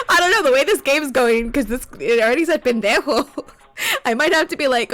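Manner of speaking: amused, loud and breaking into laughs; talking through laughter. Real, not acted. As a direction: try to talk while cracking up. Still laughing at the swear.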